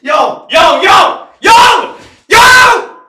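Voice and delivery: rising yells of yo; yelling to get attention; shouting. Real, not acted. Calling out over the game.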